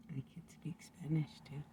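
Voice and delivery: breathy whisper, no voice at all. Acted. Whispers a second language claim to the camera. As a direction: let it slip out as a secret whisper. Bragging about speaking languages.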